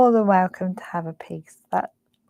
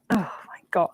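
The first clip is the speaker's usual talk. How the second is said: whispering; 2 semitones lower, 3 dB quieter, faster than usual. Real, not acted. Whispered over cake.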